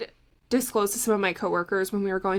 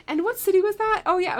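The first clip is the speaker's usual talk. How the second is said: high and louder, eagerly asking for the birth city; an excited astrologer hungry for chart details; eager excitement; 8 semitones higher, 4 dB louder than usual. Retold or imitated. Acting herself charting at parties.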